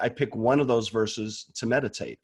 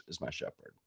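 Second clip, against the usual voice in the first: whisper; 16 dB quieter than usual. Acted. Soft prayer question.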